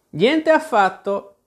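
high, slow, drawn-out scornful denial; scornful retort. A narrator's reading. Tweedledee contradicts Alice, scornful retort. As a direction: throw it back with contempt, slowly. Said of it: "ribatté con disprezzo Tweedledee".

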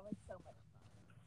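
faint, muffled, like from a tunnel. Real, not acted. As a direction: voice fading out on a bad line. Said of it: "you sound like you're in a tunnel".

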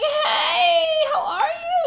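very high, loud, over-friendly hello; sing-out high, covering for a friend; fake cheery greeting. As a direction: greet her in a squeaky put-on sweetness.

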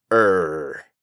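a low growled pirate R like a dog. Shown as a demonstration. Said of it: "el sonido de la R pirata o como le hacen los perros".

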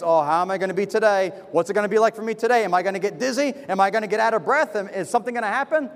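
rapid-fire worried questions, retold; anxious inner chatter. Preacher voicing his worries on walking up to the pulpit, fretting quickly. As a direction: rattle through mock nervous thoughts, one on another.

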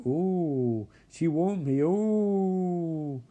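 long wavering ghostly oohs; hammed-up ghost-story voice; mock spooky. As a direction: play up a spooky warning. Acted ghostly warning, mocking.

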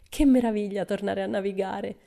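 bright, eager exclamation; exclaiming. Acted. Pippi to Tommy and Annika about sailing, eager exclamation. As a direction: burst out with joy at going to sea. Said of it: "esclamò".

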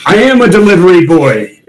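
mild hammed-up war cry; mock battle scream. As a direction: cry it out like a raging fighter, without full volume. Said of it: "and then he screams out".

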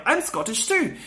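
husband faking Scottish, lightly voiced; Homer pretending. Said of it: "Homer is like".

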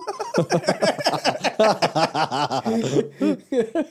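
long, high, loud laughing fit; real hearty laughter. Real, not acted. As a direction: burst out laughing and keep going. Cracks up at the festival joke.

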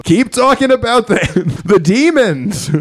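high-pitched mock kid demand; heckling kids. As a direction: whine for the demons like a young heckler. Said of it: "all the kids are like".

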